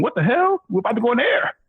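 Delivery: high-pitched baffled complaint; indignant and high, replaying your own bewilderment; retold faint protest. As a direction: squeak it out in mock disbelief. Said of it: "you can hear me faintly going".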